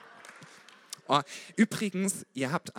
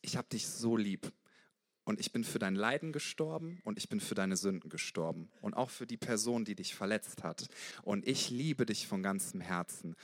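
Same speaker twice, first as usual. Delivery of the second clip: softer, lower, tender; a hint of Jesus speaking gently; loving inner voice; 6 semitones lower, 5 dB quieter than usual. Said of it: "diese liebevolle Stimme in mir".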